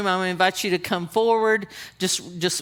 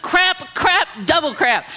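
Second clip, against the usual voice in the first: high, loud frantic "crap"; panicked cursing; 8 semitones higher, 5 dB louder than usual. Retold or imitated. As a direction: blurt it out in mock panic, voice jumping up. Panicking at the crowd forward.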